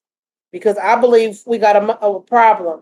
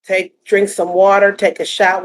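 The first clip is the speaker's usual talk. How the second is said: normal talking voice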